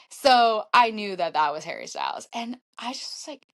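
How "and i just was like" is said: usual speaking voice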